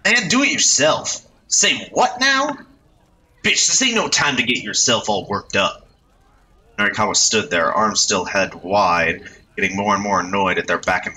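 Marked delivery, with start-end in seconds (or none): 0.0-5.9 put-on much higher, brash slangy young voice; bossy delinquent ordering his pal; cocky street punk. Youth in red bossing his companion, brash punk voice.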